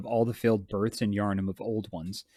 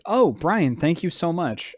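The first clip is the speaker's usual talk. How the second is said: sarcastic thanks; 7 semitones higher, 6 dB louder, slower than usual. Real, not acted.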